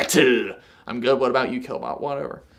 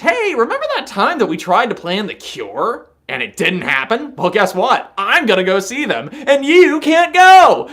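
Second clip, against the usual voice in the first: gloating taunt; 9 semitones higher, 7 dB louder than usual. Pretend. Pretend message taunting the friend who ruined The Cure trip, gloating yell. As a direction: rub it in, rising to a triumphant yell. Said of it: "send them a message".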